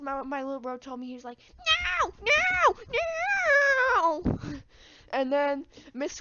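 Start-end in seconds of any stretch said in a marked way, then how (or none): 2.0-4.2 very high whiny tantrum wail; tantrum kid. Retold or imitated. Harold throwing a fit on the ground, high wail. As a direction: wail like a kid kicking the floor.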